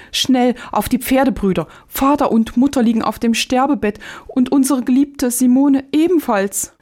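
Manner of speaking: high-pitched, frightened outcry; alarmed cry. A narrator's reading. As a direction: cry out the terrible news to your brothers. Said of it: "so schrie er erschrocken auf".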